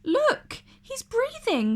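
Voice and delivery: excited discovery; cried out. Acted. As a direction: burst out with relief. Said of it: "cried Ariel".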